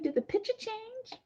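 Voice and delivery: squeaky-high aside; high-pitched quote. Retold or imitated. Quoting own surprised question.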